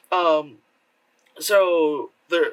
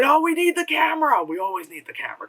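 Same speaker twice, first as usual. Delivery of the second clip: mock character voice; 9 semitones higher, faster than usual. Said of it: "It's like, like".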